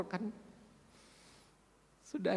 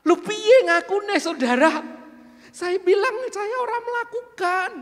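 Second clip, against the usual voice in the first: furious high shout, much louder; angry, high-pitched; 12 semitones higher, 12 dB louder than usual. Retold or imitated. Angry church member shouting at the pastor in his office. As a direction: hurl the demand at him, pitch way up. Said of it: "Dia marah nadanya tinggi dan berkata".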